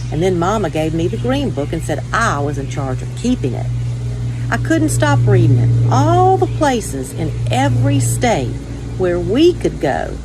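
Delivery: usual speaking voice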